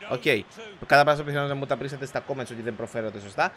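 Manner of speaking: usual speaking voice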